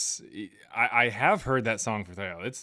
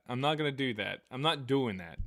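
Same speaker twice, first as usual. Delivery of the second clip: usual speaking voice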